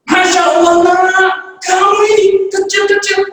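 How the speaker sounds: high, loud scolding shout; father shouting at his son over what he watched; furious father. Acted. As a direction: yell at the boy in outrage.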